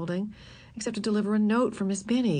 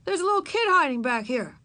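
put-on high-pitched boyish announcement; boy exclaiming; 8 semitones higher, 4 dB louder than usual. A narrator's reading. Boy reporting the hidden child, exclaiming. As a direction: call out a discovery in a raised pitch. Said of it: "exclaimed a boy's voice".

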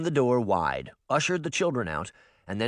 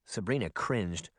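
ordinary delivery, nothing marked

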